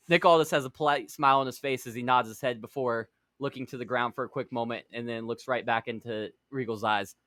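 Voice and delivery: ordinary talk, nothing marked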